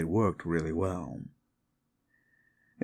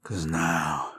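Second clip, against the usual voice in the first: southern werewolf warning the listener away; 2 semitones lower, slower than usual. Acted. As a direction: menace someone who stayed too long.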